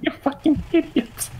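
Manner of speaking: laughing insult. Real, not acted.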